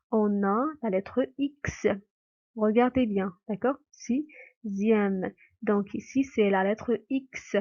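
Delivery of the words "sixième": "Sixième" broken into si, pause, zième; syllable demonstration. Shown as a demonstration. Teacher showing that X gives Z, "Sixième" in separated syllables. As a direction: split "Sixième" slowly before the z. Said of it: "qui a donné le son Z".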